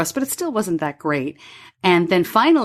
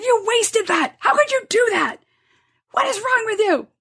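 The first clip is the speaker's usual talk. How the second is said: shrill, much higher furious outburst; mother yelling at son over wasted supply; angry yell. Retold or imitated. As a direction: lose it at your son. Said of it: "I lost it".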